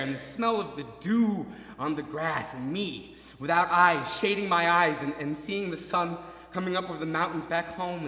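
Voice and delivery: 3 pour it out in wonder; 4 ecstatic reverie of home at dawn; 1 rapturous. Acted.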